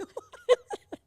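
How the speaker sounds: squealing laugh, loud; high burst of laughter. Real, not acted. Mother cracking up at her daughter's line, high laughter.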